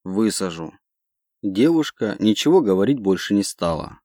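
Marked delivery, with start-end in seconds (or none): 0.0-0.7 muffled, lower distorted voice; pilot's voice through a filter. A narrator's reading. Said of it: "искажённый голос пилота".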